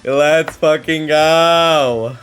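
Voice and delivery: drawn-out hype yell; loud excited cheer. Real, not acted. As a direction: stretch it out and shout. Hyped gamer repeats cheer louder.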